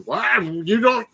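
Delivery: hammed-up high drunk customer; angry drunk caller. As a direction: squeak out the caller's exasperation. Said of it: "some drunk guy going".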